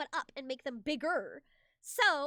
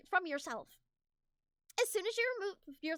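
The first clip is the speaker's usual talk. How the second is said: ordinary talk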